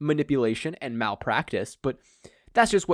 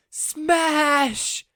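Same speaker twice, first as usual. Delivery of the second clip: much higher, held battle cry; battle shout; 5 dB louder than usual. Acted. The green hero decking the villain, shouting his attack. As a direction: yell the attack name out.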